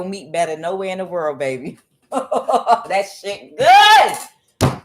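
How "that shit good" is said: laughing. Real, not acted. Laughing about the steak.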